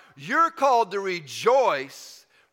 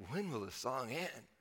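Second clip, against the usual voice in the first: put-on complaining kid; 6 semitones lower, 15 dB quieter than usual. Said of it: "I'm thinking, you know, I'm, I'm a kid".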